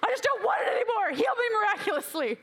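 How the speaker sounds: high, shouted whine; worked-up, hammed-up tantrum; pissed-off pleading. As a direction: demand it in acted-out desperation. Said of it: "And I'm pissed about it".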